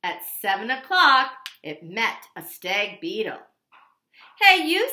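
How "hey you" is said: loud high call; loud grouchy hey you; grouchy challenge. Acted. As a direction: pick a fight.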